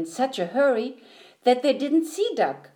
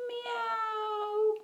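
soft, very high meow; cat meow; 12 semitones higher, 9 dB quieter than usual. A narrator's reading. Cat answers Duck's hello, mewing softly. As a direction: mew thinly like a cat. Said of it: "said Cat".

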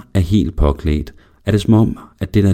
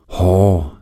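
ordinary delivery

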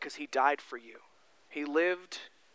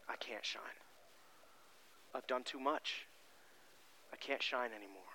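much softer, defeated low voice; hopeless murmur; 5 semitones lower, 17 dB quieter than usual. Acted. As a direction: let the words sink in despair. Peter's hopeless voice.

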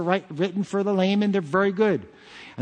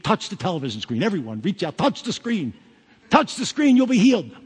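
mock TV healer; 2 semitones higher than usual. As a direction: ham up a televangelist begging the crowd to touch the screen. Mocking a TV faith healer.

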